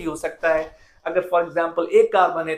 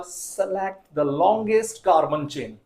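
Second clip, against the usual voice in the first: slow dictation; much slower than usual. Shown as a demonstration. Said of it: "teacher repeats rule for emphasis".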